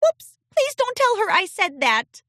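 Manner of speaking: high, flustered little filly; embarrassed filly. A narrator's reading. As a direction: catch herself too late. Filly caught out.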